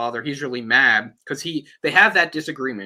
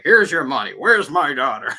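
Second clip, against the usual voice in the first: louder, punched-out imitation of a man practicing his line; rehearsed demand; 3 semitones higher, 6 dB louder than usual. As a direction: act out rehearsing a tough demand. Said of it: "rehearsing on the way".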